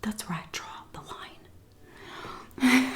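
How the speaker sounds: conspiratorial whisper; whispered aside. Retold or imitated. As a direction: drop it to a whisper. Closing line of rant.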